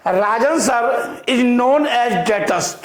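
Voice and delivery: very slow, word by word dictation; crawling dictation pace. Shown as a demonstration. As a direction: dictate it slowly for note-taking. Teacher dictating slowly to class.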